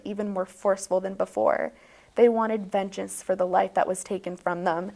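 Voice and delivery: mock storyteller reading a tall tale; playful bedtime story voice; bedtime story narration. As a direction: spin it like a bedtime story, deadpan.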